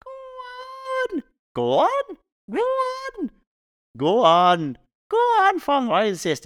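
put-on very high pleading old woman voice; Mrs Doyle. Mrs Doyle begging the priest to take tea, squealing pleas. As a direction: wheedle it up to a squeal. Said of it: "He's like".

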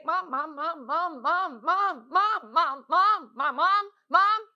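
rapid-fire mock child calling for mom; insistent kid impression, over and over; pestering kids. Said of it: "your kids have a voice and say".

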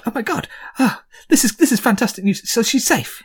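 high, eager dwarf voice; dwarf overjoyed for news of his wife; excited dwarf. Acted. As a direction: gush about the long-gone wife. Dwarf voice continues, high, delighted.

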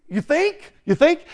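high rising sarcastic question; mock incredulity. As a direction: ask it as if stating the obvious. Mocking a huge understatement.

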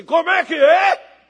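high, loud outraged yell; acted parental bellow; father's shout. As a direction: yell back like a dad who's been defied. Father's angry reply in story.

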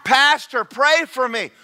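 high, very loud mocking rant; preacher's comic bellow; exasperated shout. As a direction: throw the retort back at full volume, last word stretched. Mocks request, shouts retort.

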